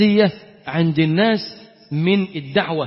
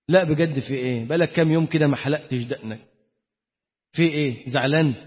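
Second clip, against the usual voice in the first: gentle father; 6 semitones lower, 3 dB quieter than usual. Retold or imitated. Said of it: "طبطب عليك".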